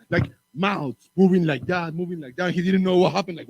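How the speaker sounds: ordinary delivery, nothing marked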